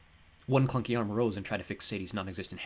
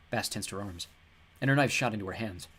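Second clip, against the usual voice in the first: plain reading voice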